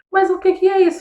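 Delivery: high, loud indignant burst; outraged disbelief, pitched high and loud; indignant outburst. Real, not acted. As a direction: throw up your hands at the absurdity. Outraged at deformação de caráter.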